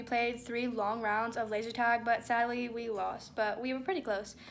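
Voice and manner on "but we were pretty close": normal talking voice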